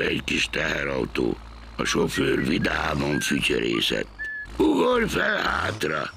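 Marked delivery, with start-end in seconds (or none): 4.6-6.1 high, slow, cheery call; cheerful driver. A narrator's reading. Driver calls to Gombóc Artúr, cheery. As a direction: sing it out like a happy driver. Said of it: "mondta a sofőr fütyörészve".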